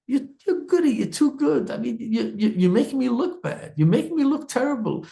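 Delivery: lightly voiced Esav griping that he's made to look bad; whiny complaint.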